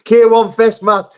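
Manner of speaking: high, loud, laughing cry; excited shout. Real, not acted. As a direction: shout it out with glee. Laughing at a fighter's punch.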